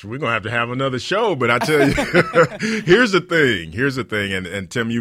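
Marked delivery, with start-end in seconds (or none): 1.3-1.9 laughing. Real, not acted. Laughing over his own remark. 2.0-2.8 a real burst of laughter, rising; hearty laugh. Real, not acted. Bursts out laughing mid-sentence. 2.8-3.7 said through a laugh, higher and louder. Real, not acted. Repeats phrase, first while laughing.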